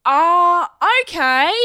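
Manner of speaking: loud, high, awkward giving in; reluctant agreement. Retold or imitated. Comedian gives in to persistent man, loudly reluctant. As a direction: imitate yourself cornered into saying yes.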